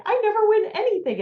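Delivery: high, loud mock complaint; imitated exclamation. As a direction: exclaim it like someone who never wins. Imitating people who never win.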